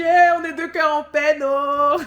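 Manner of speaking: high, loud theatrical cry; mock commiseration. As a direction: wail it playfully as fellow lonely hearts. Acts out reaction to his breakup.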